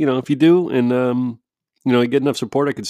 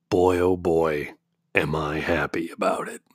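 sarcastic; 6 semitones lower, 5 dB quieter than usual. Real, not acted. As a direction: say how happy you are and mean the opposite. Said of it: "can you hear the sarcasm".

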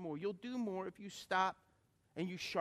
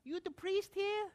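put-on higher, slow old-lady question; 13 semitones higher, slower than usual. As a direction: ask it like a curious elderly Cajun woman. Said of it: "She goes".

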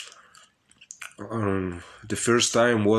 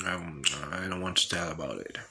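hushed coy aside; 4 semitones lower, 10 dB quieter, faster than usual. Real, not acted. Coy refusal after tasty talk.

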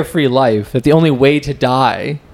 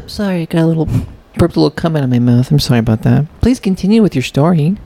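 quick, slightly higher meek apology; polite little man; 4 semitones higher, faster than usual. Pretend. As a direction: interrupt yourself politely as a timid little fellow. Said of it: "like, a polite little man".

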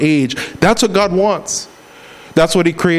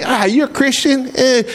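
high, sneering put-on voice; mock the believer; a sneering doubter's voice; scoffing skeptic; 9 semitones higher than usual. Society mocking a believer.

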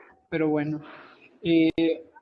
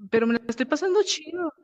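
put-on much higher girly voice; imitating the girl; 11 semitones higher than usual. Girl telling the group she's having fun, high imitated voice. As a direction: toss it off high and carefree.

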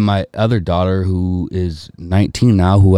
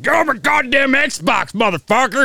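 mock yell; 14 semitones higher than usual. Uncle yelling at his nephew over the Xbox, high mock yell. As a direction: bellow it like an angry uncle. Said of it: "He's like".

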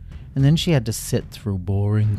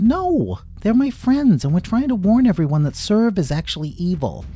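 much higher, louder pleading cry; urgent protest; 11 semitones higher, 5 dB louder than usual. A narrator's reading. Lila pleading with the guards about Serv. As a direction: insist desperately.

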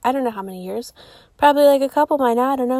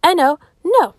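usual speaking voice

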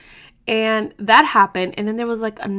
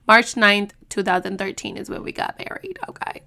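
normal talking voice

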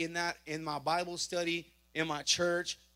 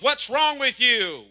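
slow, loud, higher scolding; angry cop; 5 semitones higher, 12 dB louder, slower than usual. Retold or imitated. Officer scolding the driver, slow loud angry. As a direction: bark it at him, slowly and loud. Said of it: "She goes".

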